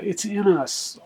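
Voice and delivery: usual speaking voice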